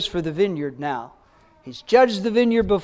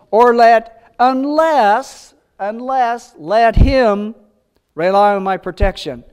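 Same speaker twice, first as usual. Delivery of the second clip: emphatic; 3 semitones higher, 7 dB louder, slower than usual. Real, not acted. Rereads verse with new meaning.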